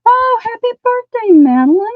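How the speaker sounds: bright, high and loud; excited birthday greeting. Real, not acted. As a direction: cheer it out happily.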